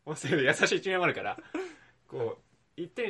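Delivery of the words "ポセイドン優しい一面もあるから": laughing. Real, not acted. Laughs at own claim.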